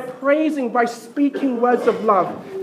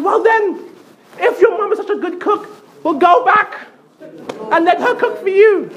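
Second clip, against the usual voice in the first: fed-up wife; 8 semitones higher than usual. Retold or imitated. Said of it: "my aunt said".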